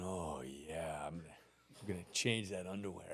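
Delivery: put-on teen boy imagining his chance, muttered low; sly inner thought. Teen boy's eager inner thought.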